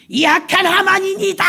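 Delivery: high, loud denunciation; queen crying out the culprit; accusing shout. Acted. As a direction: point and name the villain. Esther accuses Haman.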